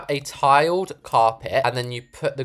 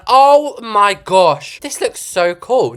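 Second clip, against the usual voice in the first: slow, high, loud "oh my gosh"; amazed; 8 semitones higher, 8 dB louder, much slower than usual. Real, not acted. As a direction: gasp it out in awe. Amazed by the build.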